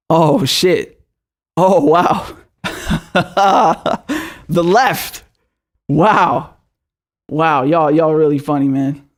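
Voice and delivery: derisive laughing wow and oh shit; scoffing laugh. Real, not acted. Mocking the American left, scornful laughter. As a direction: laugh it off in disbelief.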